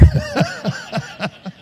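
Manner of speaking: sudden high burst of laughter; real laugh high above the voice. Real, not acted. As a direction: laugh at your own punchline. Laughs at own joke.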